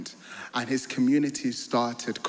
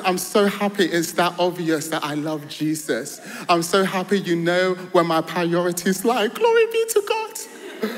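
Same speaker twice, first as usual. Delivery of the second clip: much higher and louder, bursting with joy; joyous shout of praise to mocking friends; overjoyed exclamation; 7 semitones higher, 6 dB louder than usual. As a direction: let the joy spill over, rising high. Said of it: "I was joyful".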